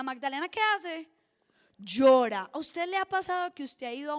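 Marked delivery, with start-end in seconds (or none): 1.8-2.5 "Llora" punched much louder; emphatic stress. Real, not acted. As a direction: hit the single word "Llora" hard. Preacher stresses what Magdalena does. ("Llora" dicho con fuerza)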